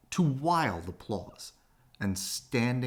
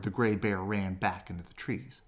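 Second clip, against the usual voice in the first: ordinary delivery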